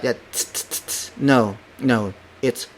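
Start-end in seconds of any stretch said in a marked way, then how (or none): 0.3-1.1 voiceless puffs of "t" alone; aspirated T. Shown as a demonstration. Teacher shows what to avoid, airy "t" puffs. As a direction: spit out bare airy "t" sounds. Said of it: "It doesn't have that".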